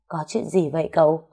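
usual speaking voice

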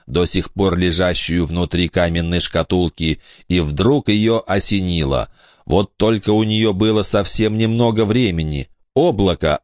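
ordinary delivery

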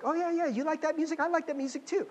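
high, gushing middle-schooler trying to fit in; eager people-pleaser. Retold or imitated. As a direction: chirp agreement to impress friends. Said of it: "and like".

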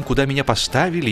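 plain reading voice

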